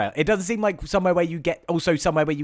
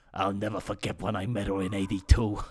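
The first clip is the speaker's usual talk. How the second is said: reminiscing dad; 3 semitones lower than usual. Retold or imitated. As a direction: drift into the memory, voice dropped low. Dad reminisces about dead ex-wife.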